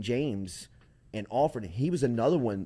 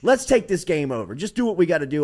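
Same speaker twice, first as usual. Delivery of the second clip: louder, higher, fast fired-up team call; rallying; 5 semitones higher, 9 dB louder, faster than usual. Retold or imitated. Kyle Williams rallying teammates in the locker room, loud and fast. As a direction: pump the team up to finish. Player rallies team at halftime.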